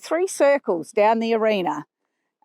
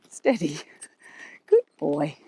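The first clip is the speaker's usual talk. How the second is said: whispered steady; 5 semitones lower than usual. Real, not acted. Rider whispering to her young horse to keep him calm. Soothing the horse mid-ride.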